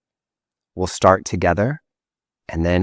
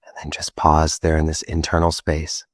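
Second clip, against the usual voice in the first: guided meditation; 2 semitones lower than usual. Acted. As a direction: guide them gently back to the room. Leads a meditation.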